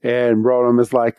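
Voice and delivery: ordinary talk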